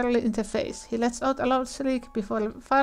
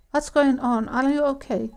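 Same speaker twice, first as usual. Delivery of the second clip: normal talking voice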